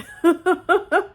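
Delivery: a burst of high laughter; high loud laugh. Real, not acted. Reactor cracking up at the story, high loud laugh. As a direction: cackle out loud.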